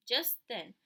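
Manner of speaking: ordinary delivery, nothing marked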